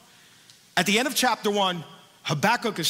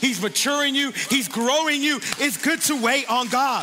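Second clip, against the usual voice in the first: preaching over music; 4 semitones higher than usual. Real, not acted. Pastor urging the waiting congregation, fervent preaching. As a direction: lift them up, preach it with growing force.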